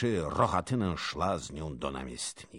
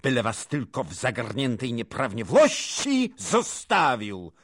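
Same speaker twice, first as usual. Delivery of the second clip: loud, indignant charge climbing higher; forceful, raised-voice denunciation; booming accusation; 6 semitones higher, 12 dB louder than usual. A narrator's reading. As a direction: thunder the accusation at them. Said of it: "Huknął".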